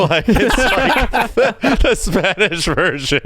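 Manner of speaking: laughing through the words. Real, not acted. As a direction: crack up while saying it. Laughing at the cover.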